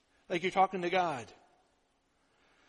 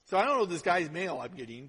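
usual speaking voice

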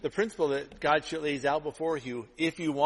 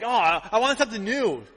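mock craving; 6 semitones higher, 5 dB louder than usual. Acting out people craving novelty.

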